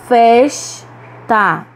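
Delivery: "Festa" split in two, strong Fes; stress on penultimate syllable. Shown as a demonstration. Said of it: "pronunciamos com mais força a penúltima". ("Festa" silabado, com força em Fes)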